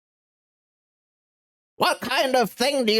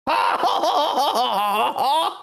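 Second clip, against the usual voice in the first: villain laugh; 4 semitones higher than usual. Acted.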